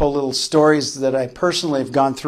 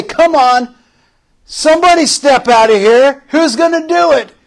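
much higher and louder, shouted dare; taunting giant; 9 semitones higher, 7 dB louder than usual. Acted. Giant taunting the frightened Israelite army, shouting. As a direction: bellow a challenge like a bragging giant. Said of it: "the giant would come out every day".

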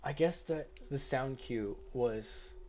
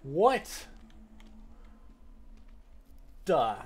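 startled yell; 2 semitones higher than usual. Real, not acted. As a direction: shout it in shock. Startled again.

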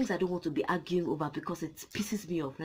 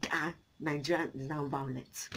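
a light, lower, softer sneer in someone else's voice; mock outsider voice; 5 semitones lower, 7 dB quieter than usual. Mimicking foreigners who call Nigeria violent, lightly mocking. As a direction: toss it off like the people she's mocking.

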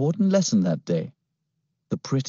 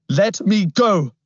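high, loud, slow shout; 11 semitones higher, 5 dB louder, slower than usual. Acted. Boy on the scooter shouting at his captor. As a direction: yell to be let go. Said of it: "I shouted".

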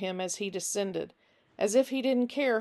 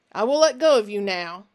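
put-on louder, gripping threat to a captured boy; menacing stranger Finch; 9 dB louder than usual. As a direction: snarl a warning while holding him tight. Finch commands Nick.

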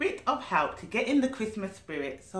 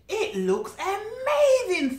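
high, slow awed voice; amazed delight; 12 semitones higher, 4 dB louder, slower than usual. Acted. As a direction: stretch out the wonder. Squirrels admire the tree.

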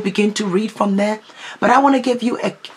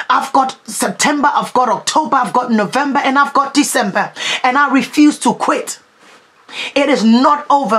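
fiery preaching; 4 semitones higher than usual. Acted. Preacher rallying a weary congregation, forceful preaching.

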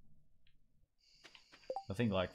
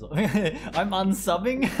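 loud laughing question, slowed right down; laughing disbelief; 10 semitones higher, 9 dB louder, much slower than usual. Real, not acted. Laughing off a viewer threatening to unsubscribe, loud and slow.

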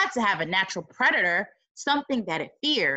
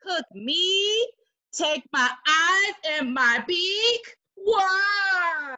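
octopus voice; 7 semitones higher, much slower than usual. Acted. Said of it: "said the octopus".

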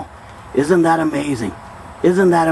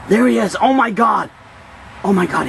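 excited; 7 semitones higher than usual. Pretend. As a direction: gasp with thrilled surprise. Spots the creature again.

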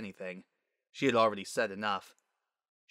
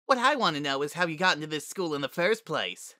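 higher, jeering unicorn bully; taunting school bully; 6 semitones higher, 3 dB louder than usual. Acted. Bully mocks a fallen classmate in the cafeteria, taunting. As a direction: jeer at the one you tripped.

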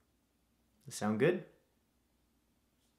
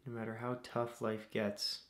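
soft, unhurried meditation voice with long pauses; guided meditation; 7 dB quieter than usual. Acted. Host leading listeners through breathing to calm anxieties, guided meditation. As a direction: guide them gently through each breath, leaving space.

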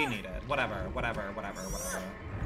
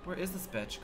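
ordinary delivery

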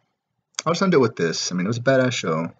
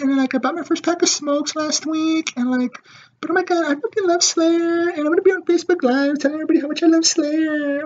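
mocking teen fangirl. Mocking a phone-filming fan at the Slayer show, high squealy falsetto. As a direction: gush like a giddy underage fan on her phone. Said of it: "and be like".

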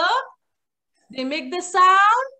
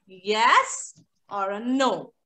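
slow, drawn out; 9 semitones lower, much slower than usual. Shown as a demonstration. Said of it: "And you will say".

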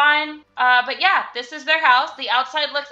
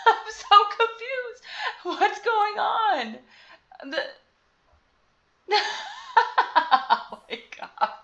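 laughing in disbelief at a glitch; confused laughter; 8 semitones higher, slower than usual. Real, not acted. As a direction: giggle through the confusion, pitched high. Game glitch baffles her.

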